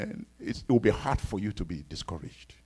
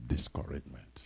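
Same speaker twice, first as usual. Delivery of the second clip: whisper; 11 semitones lower, 10 dB quieter than usual. Acted. Solemn repeated word.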